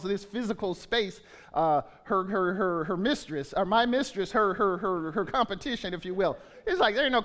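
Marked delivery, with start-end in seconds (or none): none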